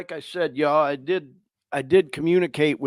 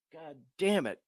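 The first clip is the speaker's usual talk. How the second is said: much softer curse, caught in the throat; swallowing back tears; choked up; 10 dB quieter than usual. Real, not acted. Host overwhelmed by a listener's success, choked up. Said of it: "that I'm getting emotional".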